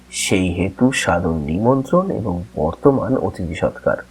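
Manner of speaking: ordinary talk, nothing marked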